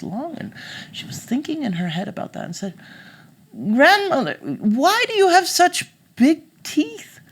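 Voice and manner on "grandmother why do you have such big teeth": put-on high, slow, wondering girl. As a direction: ask it slowly in a high little-girl voice. Girl questions disguised wolf.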